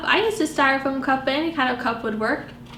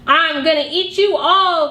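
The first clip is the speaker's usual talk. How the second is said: growl; 3 semitones higher, 4 dB louder than usual. Acted. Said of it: "growls Mister Wolf".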